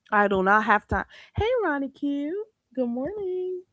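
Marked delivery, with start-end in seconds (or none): 1.3-3.6 lightly put-on sweet greeting voice, much higher on the hello; a hint of Clydiva's sugary hello; sugary online-host greeting, only lightly voiced. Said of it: "That's in my best Clydiva voice".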